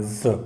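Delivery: ज said as a voiced z; ज with a z buzz; z sound demo. Shown as a demonstration. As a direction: sound ज like the s in vision. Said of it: "उसको ज पढ़ना है".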